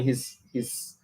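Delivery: ordinary talk, nothing marked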